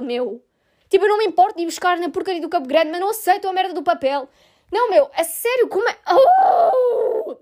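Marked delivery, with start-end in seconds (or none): 6.1-7.4 a high loud exasperated cry; frustrated shout. Real, not acted. Venting to listeners about the bus card, a loud cry. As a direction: burst out in pure exasperation.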